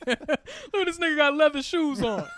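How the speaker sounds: loud, high, laughing jeer; mocking a rival over his leather shoes, laughing; laughing mockery. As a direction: cackle at him while you mock.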